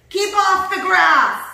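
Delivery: high, very loud, drawn-out shout. A narrator's reading. Cactus warns off the cowboy, shouting. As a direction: bellow a warning at a passerby. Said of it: "shouted Hank".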